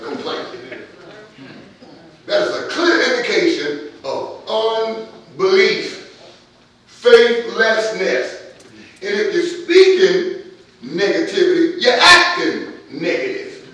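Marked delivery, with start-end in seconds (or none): 2.2-13.7 loud, slow, drawn-out preacher cadence; hammering the point home to the congregation; full-force preaching. Acted. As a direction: preach it out, leaning on every word. Preacher builds to a shout.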